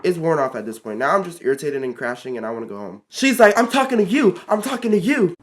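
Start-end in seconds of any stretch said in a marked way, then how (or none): 3.6-5.3 high-pitched imitation of an angry coworker; confrontational. Coworker confronting the teen, imitated angry insistence. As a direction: push it higher, insisting on the listener. Coworker snaps back in argument.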